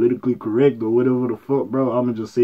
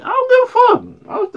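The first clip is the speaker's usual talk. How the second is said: high defiant yell; 5 dB louder, slower than usual. Real, not acted. Defiant outburst.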